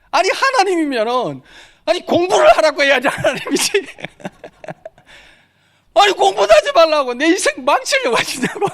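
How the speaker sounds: high, loud indignant yelling with laughter; angry shout at God. Retold or imitated. Hungry student shaking his fist at God, shouting angrily. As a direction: rail at heaven, half laughing. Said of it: "하늘을 향해서 제가 막 삿대질을 했습니다".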